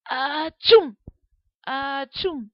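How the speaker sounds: loud mock sneeze. Pepper-filled kitchen making everyone sneeze, loud sneeze.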